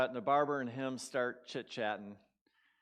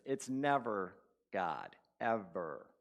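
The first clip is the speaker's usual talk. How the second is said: slow, pressing on "never"; preacher insisting to the congregation; slow emphasis; 4 semitones lower, slower than usual. Acted. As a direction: plant each word firmly.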